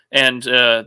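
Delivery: ordinary delivery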